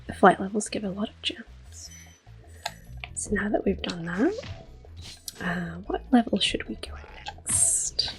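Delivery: usual speaking voice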